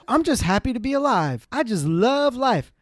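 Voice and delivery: high, beaming, gushing about love; blissful driver. Retold or imitated. Driver telling passenger he loves everyone, blissfully high. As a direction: gush like you adore the whole world. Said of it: "He's like".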